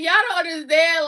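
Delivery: ordinary delivery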